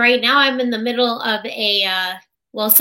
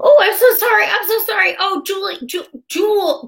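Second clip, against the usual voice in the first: high-pitched, loud rush of sorries; flustered apology; 10 semitones higher, 4 dB louder than usual. Retold or imitated. Tech apologizing to patient Julie, high and flustered. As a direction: gush apologies, mortified.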